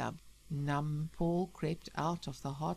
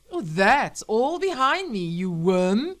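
loud, scornful retort; contemptuous anger; 4 semitones higher, 11 dB louder, slower than usual. Acted. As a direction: dismiss him with furious contempt. Wife about to shoot husband.

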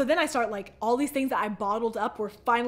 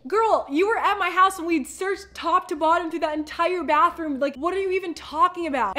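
higher and louder incredulous; girl, really; exasperated disbelief; 6 semitones higher, 5 dB louder than usual. Retold or imitated. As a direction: call her out. Confronting Carrie in disbelief.

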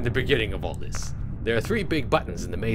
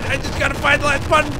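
high panicked pleading; frantic, loud, higher voice; scared horror game streamer; 5 dB louder, faster than usual. Real, not acted. Chased, repeats line.

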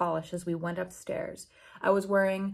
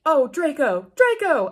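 high, louder hammed-up cry of his name; mock scream; 6 semitones higher, 5 dB louder than usual. A narrator's reading. Heroine crying out to Draco, mock scream. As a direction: overact the name, half-screamed. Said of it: "I screamed".

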